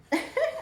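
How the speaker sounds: quick rising giggle; short laugh. Real, not acted. Mother filming baby, short rising laugh.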